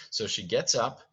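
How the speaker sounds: ordinary delivery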